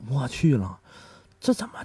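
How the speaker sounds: usual speaking voice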